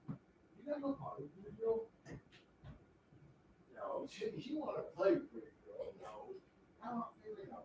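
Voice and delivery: very soft breathy whisper to the dog; whispered pleading with the dog to go. Real, not acted. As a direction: hush the dog so the stream can't hear. Whispering to dog while streaming.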